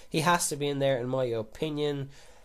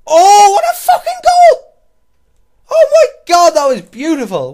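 huge cracking goal scream, much higher and louder; screaming at the goal till the voice breaks, slowly; voice breaking shout; 23 dB louder, slower than usual. Real, not acted. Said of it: "What happened to my voice there".

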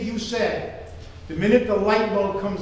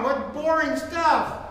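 ordinary delivery

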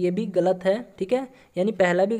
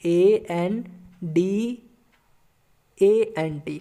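letters spaced out with pauses; spelling out slowly; much slower than usual. Shown as a demonstration. Teacher dictating a correct spelling, letter by letter. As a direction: spell it slowly so students can write. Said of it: "इसको सही कैसे लिखिएगा?".

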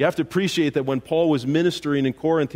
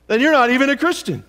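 rising to a sharp, fast, much louder, higher jab; mock harsh pastor; 8 semitones higher, 5 dB louder than usual. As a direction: scold them as a mock judgmental pastor. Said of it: "and I say".

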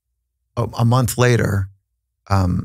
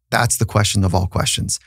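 usual speaking voice

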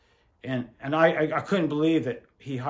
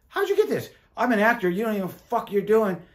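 indignant disbelief; 6 semitones higher than usual. Retold or imitated. Actor friend indignant at him.

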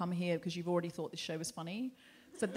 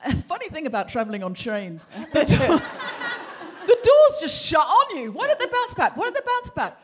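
much higher, louder hammed-up comic voice; cheesy stand-up bit; 7 semitones higher, 13 dB louder than usual. Mock stand-up routine on a train, high and loud with laughter. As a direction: ham up a corny train joke. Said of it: "I wasn't too appropriate to go".

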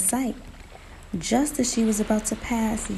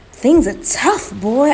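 rousing self-talk; 5 semitones higher, 9 dB louder, slower than usual. Acted. Talks himself into a plan.

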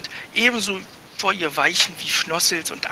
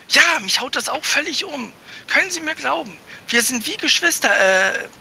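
put-on much higher, louder, pleading boyish voice; a boy scrambling to justify himself; flustered young boy; 9 semitones higher, 3 dB louder than usual. (ein verlegener Junge, hoch und flehend)

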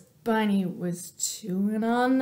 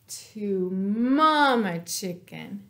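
ordinary delivery

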